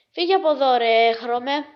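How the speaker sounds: loud, hammed-up telling-off; acted angry order. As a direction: send them packing, loudly. Acted quarrel in a joke. (δήθεν θυμωμένη φωνή)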